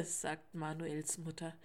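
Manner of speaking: ordinary delivery, nothing marked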